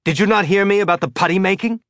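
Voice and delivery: high, loud, fast shout. Acted. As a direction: rattle it off in a panicked yell. Holden shouting at Wei.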